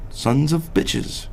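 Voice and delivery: normal talking voice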